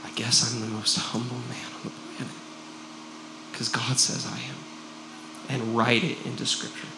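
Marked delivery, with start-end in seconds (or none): 0.0-4.6 quiet resigned Moses. Retold or imitated. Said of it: "he can say".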